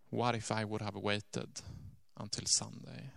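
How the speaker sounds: hushed inner thought. Retold or imitated.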